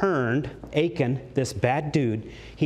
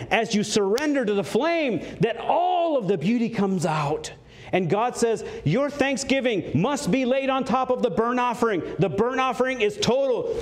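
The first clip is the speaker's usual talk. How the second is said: rising, fervent sermon voice, pitched higher; impassioned preaching; 7 semitones higher than usual. Acted. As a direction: preach it with growing fire. Preacher building to passionate climax.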